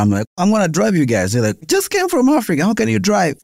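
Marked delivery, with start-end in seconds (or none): 0.4-1.3 casual offer. Retold or imitated. Retold offer to friends. 1.7-3.4 put-on very high, scoffing teen voice; mocking disbelief. Mocking friends' doubt.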